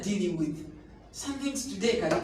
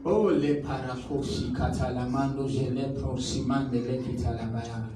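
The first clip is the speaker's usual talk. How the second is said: speaking in tongues; 8 semitones lower than usual. Real, not acted. Preacher prays in tongues.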